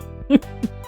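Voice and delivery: short, soft laugh; quiet giggle; amused chuckle. Real, not acted. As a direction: chuckle at your own joke. Laughs after own bit.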